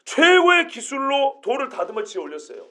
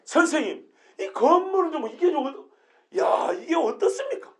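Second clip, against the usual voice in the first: indignant question; 3 semitones higher, 4 dB quieter than usual. Shown as a demonstration. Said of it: "그러면서 건물을 말하는 거예요".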